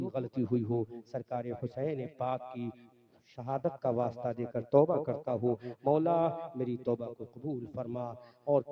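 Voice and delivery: low-pitched, projected congregational prayer; leading prayer. Real, not acted. Preacher asking forgiveness through Husain's martyrdom, solemn public prayer. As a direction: lead the supplication in measured cadences. Leads repentance prayer and salutations.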